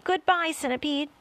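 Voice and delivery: a last goodbye while falling; gasped farewell. A narrator's reading. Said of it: "gasped James".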